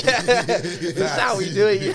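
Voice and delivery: laughing through the words, slow. Real, not acted. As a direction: laugh it out with pride. Laughing about Kent pride.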